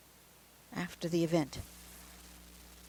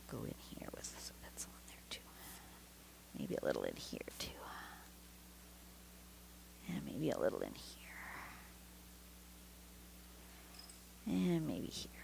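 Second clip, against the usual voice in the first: whispering; 18 dB quieter than usual. Real, not acted. Muttering to herself while shading.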